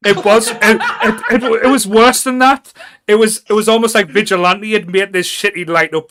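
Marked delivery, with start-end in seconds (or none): 0.1-4.9 high, loud laughing through the words. Real, not acted. Host laughs about the ridiculous helmet, laughing loud. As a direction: talk while cracking up.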